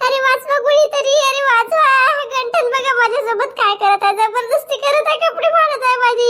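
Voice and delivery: loud, high staged yelling for rescue; fake cry for help. Wife fakes being attacked so neighbours gather, loud false alarm. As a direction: ham up the panic to draw a crowd. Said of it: "मी कल्ला करेल बरं".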